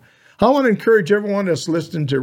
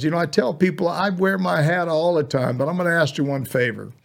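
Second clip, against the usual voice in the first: normal talking voice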